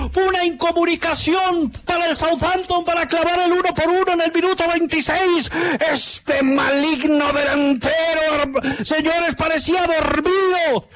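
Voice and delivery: high, shouted goal call with long gols; breakneck goal commentary. Acted. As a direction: scream the goal like a radio announcer. Live goal call on radio.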